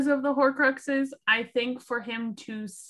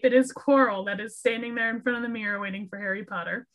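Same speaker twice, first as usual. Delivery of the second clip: ordinary talk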